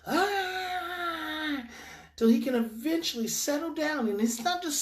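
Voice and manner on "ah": high, sustained mock cry; restrained imitation of a meltdown; imitated child's wail. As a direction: hold a long wail like an upset boy. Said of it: "stay present with him in that moment".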